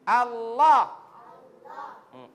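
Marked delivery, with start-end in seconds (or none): 0.0-0.9 Allah louder, clear lam; Allah with the tongue kept in; correct Allah. Shown as a demonstration. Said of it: "Jangan kasih keluar itu lidah". (Allah dengan lidah tidak keluar)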